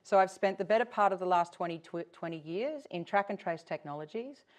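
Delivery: ordinary talk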